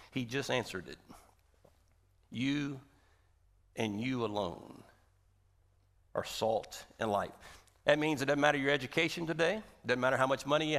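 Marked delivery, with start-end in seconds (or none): 2.3-7.3 softer, lower and slow; hushed emphasis. Real, not acted. Preacher to congregation, suddenly hushed and slow. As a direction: drop it down to an intimate hush. Quiet slow repetition after climax.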